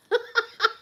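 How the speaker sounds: very high-pitched giggle; real laugh. Real, not acted. Joking about dating profiles, high laughing. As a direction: giggle high at your own joke. Laughs at her own joke.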